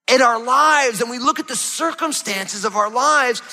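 ordinary talk, nothing marked

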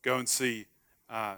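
ordinary talk, nothing marked

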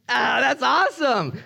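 high, drawn-out cheer; hammed-up happy reaction; acted delight. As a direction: celebrate it, stretched out and high. Acting cheer at raising from dead.

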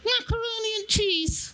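very high squeaky voice; small squeaky answer; squeaky puppet voice. Pretend.

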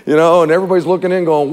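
normal talking voice

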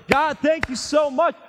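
loud, slow, high thanks to God; joyful praise. Acted. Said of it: "I'll praise God and say".

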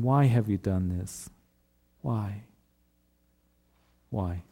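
soft, fading grieved plea; brokenhearted. Retold or imitated. Pastor voicing the grieving Lord to his church, soft and fading. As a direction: let the whys die away in sorrow. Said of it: "a brokenhearted Lord here that is saying".

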